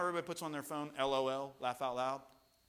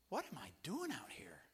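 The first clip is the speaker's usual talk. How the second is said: acted whisper to himself; hushed, dramatized second thoughts; whispered self-doubt; 13 dB quieter than usual. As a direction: whisper it as a worried private thought. Voicing own doubt on shaky pier.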